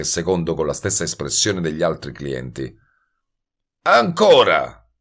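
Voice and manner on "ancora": loud, high demand for more; clamouring. A narrator's reading. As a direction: holler it, stretched out and insistent. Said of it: "strepitò Goebel". (urlo acuto, prepotente)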